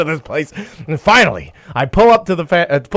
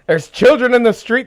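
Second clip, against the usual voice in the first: ordinary delivery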